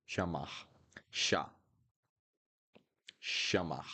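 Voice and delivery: chamar with ch as sh; Portuguese ch. Shown as a demonstration. Teacher models chamar, ch said as sh. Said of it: "that's the sound of C H".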